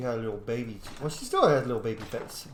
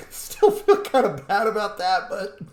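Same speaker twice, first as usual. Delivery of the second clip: talking through laughter, pitched high and louder; laughing; 9 semitones higher, 5 dB louder than usual. Real, not acted. Gamer laughing over a scared character in a cutscene, amused. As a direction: squeeze the words out between bursts of high laughter.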